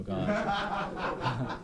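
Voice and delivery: high, laughing mock dismay; a rueful, half-laughing "here we go"; dismayed groan. Dreading the North Carolina caller.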